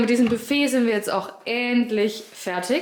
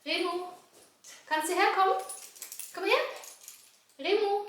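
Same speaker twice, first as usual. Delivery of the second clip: calling the dog; 9 semitones higher, 6 dB quieter than usual. Real, not acted.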